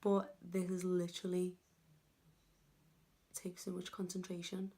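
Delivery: breath held, hushed strained words; holding breath. Real, not acted. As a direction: talk while holding your breath. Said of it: "I'm holding my breath as well".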